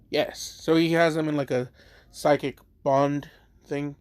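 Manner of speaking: ordinary delivery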